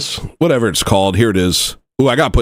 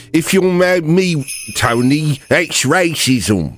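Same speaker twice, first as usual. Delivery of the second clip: higher-pitched imitation of a South African accent; 3 semitones higher, slower than usual. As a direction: put on a South African accent, stretching the last words. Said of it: "that's in my South African accent".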